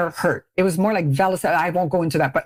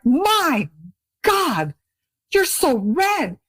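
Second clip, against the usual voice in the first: higher, slow gasping "you're so red"; mock shock; 7 semitones higher, much slower than usual. Friend after lip wax, mock horrified at redness. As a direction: act aghast at her red skin.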